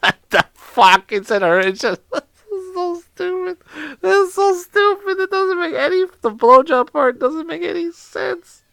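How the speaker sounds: high, squeaky words through laughter; helpless laughter. Real, not acted. Baffled by a senseless punchline, laughing helplessly. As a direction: lose it laughing while trying to complain.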